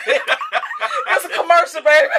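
slow, loud and high; drawn-out playful shout. Pretend. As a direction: stretch it out like a hype call. Drawn-out joking call.

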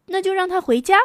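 an unbothered mom waving it away; mother brushing off. Acted. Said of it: "妈妈说".

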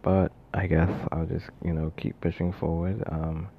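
talking after wisdom teeth removal; sore mouth. Real, not acted. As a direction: sore mouth, careful swallowing. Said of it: "Sorry if I sound bad. I got my wisdom teeth out and it hurts to swallow".

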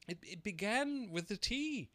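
Hatter voice. A narrator's reading. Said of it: "the Hatter replied".

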